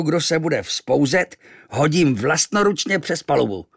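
Captain Achab roaring at noisy sailors. Acted. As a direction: bellow orders at the crew. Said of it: "zařval".

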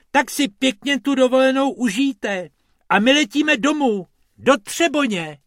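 high, loud farewell; cheerful rabbits. Acted. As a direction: wave them off happily. Rabbits saying goodbye.

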